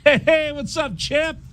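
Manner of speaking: high, excited hey; eager fan hello. Shown as a demonstration. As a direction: greet the champ like a thrilled fan. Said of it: "I would walk by and go".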